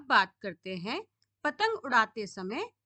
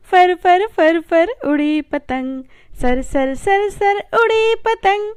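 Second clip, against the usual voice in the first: put-on rhythmic chanted children's poem; poem recitation; 7 semitones higher, 12 dB louder than usual. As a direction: chant the kite verses with a bouncing beat.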